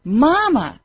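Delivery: high cry, falling away; scream. A narrator's reading. Falling boy calls his missing mother, high scream. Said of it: "he screamed".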